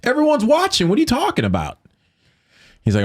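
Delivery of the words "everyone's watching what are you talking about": much higher, indignant outburst; retold rage at being pulled off the plane; exasperated outburst. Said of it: "I go".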